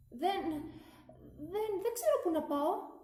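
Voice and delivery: high, halting, frightened stammer; stammering plea. A narrator's reading. Lonely water glass begging the others for a place, high and stammering. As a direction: stumble over the words, lost and scared.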